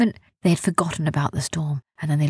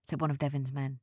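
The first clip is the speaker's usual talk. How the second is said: ordinary delivery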